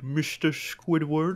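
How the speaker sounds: a lightly voiced Plankton; Plankton impression. Copying his friend's impression, lightly voiced Plankton. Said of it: "I'll do an impression of Plankton".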